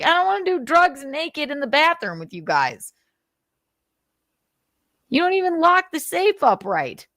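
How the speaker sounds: high, nasal-free whiny imitation of Aaron; mocking whiny voice. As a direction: whine it like a mocked prude. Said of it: "He's like".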